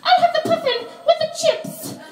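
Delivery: very high mum voice; squeaky over-eager order; mocking mum ordering. Comic mimicking her mum ordering food, very high. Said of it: "My mum sits there and she's like".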